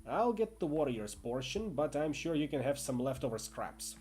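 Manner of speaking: lightly voiced Northern English bully; Northern English accent. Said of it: "his accent working-class Northern English".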